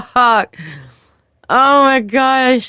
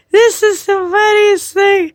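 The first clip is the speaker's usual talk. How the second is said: amused, still laughing; 7 semitones higher than usual. Real, not acted. Laughing at the picture.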